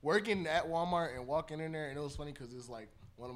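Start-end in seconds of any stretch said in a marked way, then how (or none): none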